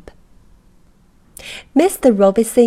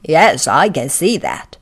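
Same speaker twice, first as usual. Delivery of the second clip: louder, surprised grown-up voice; Mister Robinson's voice; 4 semitones lower, 5 dB louder than usual. A narrator's reading. Mister Robinson spotting the tiny man, loud. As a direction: boom out a surprised hello.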